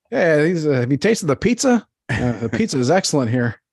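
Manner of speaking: high, polite, mild soccer-fan voice. Retold or imitated. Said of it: "they're just like".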